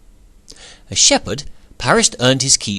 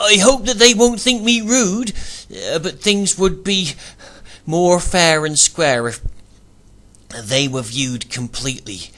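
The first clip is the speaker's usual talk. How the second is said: flustered; 6 semitones higher than usual. A narrator's reading. Paris flustered request.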